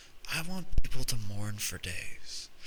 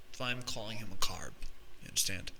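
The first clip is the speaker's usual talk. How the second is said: ordinary delivery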